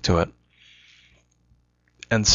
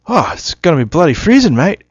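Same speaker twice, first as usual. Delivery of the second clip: high, loud mock Aussie accent; Australian accent; 9 semitones higher, 6 dB louder than usual. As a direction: exclaim it in a hammed-up Australian accent. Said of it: "I can't actually remember my Australian accent".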